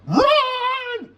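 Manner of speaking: high, loud panicked scream. A narrator's reading. Missus Farkle warning the crowd, high loud scream. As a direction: scream it at the top of your voice. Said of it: "she screamed".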